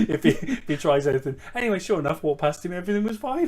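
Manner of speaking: giggling while telling the story; laughing. Real, not acted.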